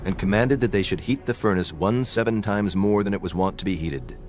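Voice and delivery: ordinary talk, nothing marked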